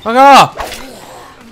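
high, loud outburst; sudden real shout of annoyance; angry yell. Real, not acted. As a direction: yell it at an attacker. Yelling at game enemy.

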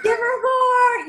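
high, loud, stretched-out call; jubilant guide. Acted. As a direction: holler eagerly to the whole team, slowly. Visualization of, like, your guy.

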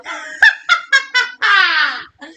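high, falling witch cackle; witch's laugh. A narrator's reading. Witch laughing aloud in the storm, long high cackle. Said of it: "The witch laughed aloud".

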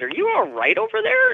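retold alarm, pitched very high; alarmed check. She checks on her screaming girlfriend, high retold alarm. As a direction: squeak out a startled question.

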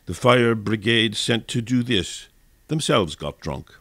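ordinary talk

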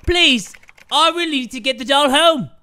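high, loud British plea; British accent. Acted. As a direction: beg urgently in mock British. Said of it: "He developed a British accent".

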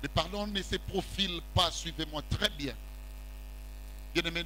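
usual speaking voice

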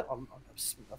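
very soft, under-the-breath muttering; muttered reply. Retold or imitated. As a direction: mutter it so the commander can't hear. Recruit's muttered reply to commander. (kendi kendine mırıldanarak)

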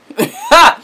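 high, loud laugh; burst of laughter. Real, not acted. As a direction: laugh it off brightly. Laughs at her own plug.

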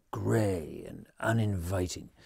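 ordinary delivery

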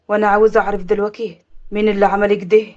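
low, controlled man's voice; stern avenger holding it in; firm demand. Acted. Nephew demands the culprit's name.

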